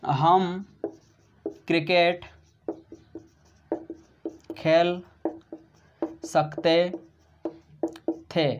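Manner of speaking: word by word with long pauses; dictating each word apart; slow dictation. Shown as a demonstration. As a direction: give students time to write. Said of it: "क्या बोला दोस्तों मैंने?".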